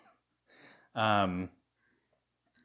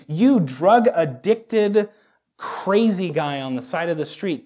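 loud, high hail to a stranger; single someone out across the street; raised, emphatic preacher's call; 5 semitones higher, 8 dB louder, slower than usual. Acted.

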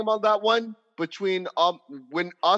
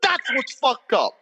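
rising anger; 6 semitones higher than usual. Real, not acted. Man venting to friends about police killings, growing angry. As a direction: let the outrage climb line by line. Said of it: "And I'm getting angry".

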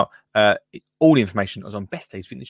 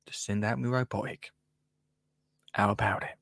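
smug hush; 4 semitones lower, 11 dB quieter than usual. Acted. Ad punchline, smug.

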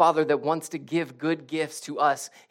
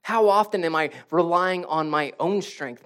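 ordinary talk

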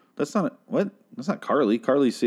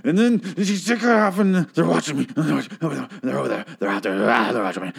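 rambling homeless man; 4 semitones higher than usual. Retold or imitated. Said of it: "And he's just saying, like".

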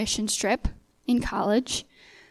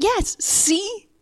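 much higher, mock-panicked agreement; high-pitched vindication; 10 semitones higher than usual. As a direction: squeak it up high to prove your point. Nervous high voice proves her point.

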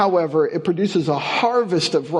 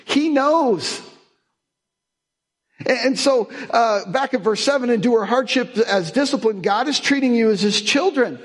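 high, raised pulpit drive; preaching at full force; 8 semitones higher than usual. Acted. Pastor telling the congregation hardship is training, with full preaching force. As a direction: sell the hope to the room.

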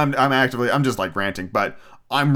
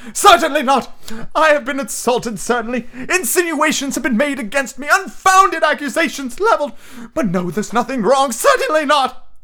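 high, hammed-up voice throbbing with emotion; Professor Trelawney; 4 dB louder than usual. A narrator's reading. Insulted teacher protesting to student, high throbbing melodrama. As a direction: wail indignantly, far above your usual pitch. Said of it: "the voice throbbing with emotion".

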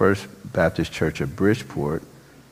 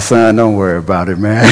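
louder, laughing through it; loud laughing reassurance; 4 semitones higher, 14 dB louder than usual. Retold or imitated. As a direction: laugh it off loudly to the kid.

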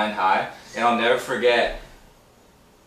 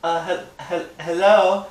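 higher, hesitant woman's phone answer; doing a woman; 6 semitones higher than usual. Pretend. Friend answers a pretend call in a woman's voice. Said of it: "I'm doing a woman".

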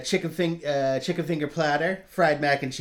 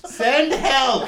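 mock cry for help; 11 semitones higher, 7 dB louder than usual.

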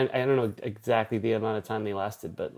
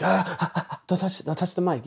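fussy studio engineer; 6 semitones higher, 3 dB quieter than usual. Retold or imitated.

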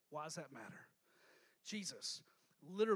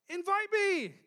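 higher, louder invitation; pleading call; 10 semitones higher, 9 dB louder, slower than usual. Retold or imitated. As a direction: call out to them to be let in. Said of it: "is all he's saying".

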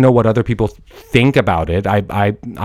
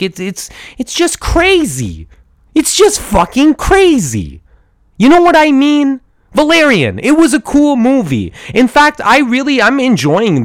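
excited; 12 semitones higher than usual. Real, not acted. Enthusiastic ranting about film.

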